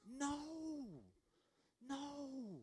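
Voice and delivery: high, soft, long-drawn no; drawn-out mock dismay. Preacher answering a mistaken believer, high soft dismay. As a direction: croon a long, high no, gently falling away.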